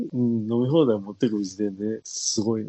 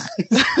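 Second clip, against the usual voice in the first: high laugh. Real, not acted. Laughs at the odd-sounding name.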